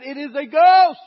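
a full-voiced scream of fear; terrified shout. Disciples in the storm see a figure on the water, terrified shout. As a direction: cry out as if you saw a ghost. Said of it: "They cried out in fear".

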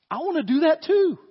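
high, loud burst of eagerness; eager outburst. Acted. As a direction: blurt it like a keen child wanting in. Acts Peter's eager first thought.

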